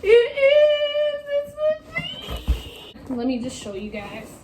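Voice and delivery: high-pitched giddy voice; excited squeal. Real, not acted. Unboxing coffee maker, excited.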